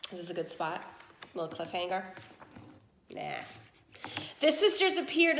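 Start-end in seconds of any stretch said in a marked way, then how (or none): 0.1-3.4 low, soft playful question; teasing aside. Real, not acted. Reader teases the children about a cliffhanger, softly. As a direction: tease the listeners about stopping. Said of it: "narrator teases cliffhanger".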